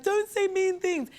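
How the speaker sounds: put-on high, slow, teary little-girl whine; crying kid's plea. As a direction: plead like a hurt child on the verge of tears. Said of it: "and I'd be crying".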